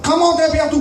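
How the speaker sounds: put-on high French with a Chinese accent; mock Chinese accent. French class exchange retold in a high mock Chinese accent. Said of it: "I had no idea that's not the accent".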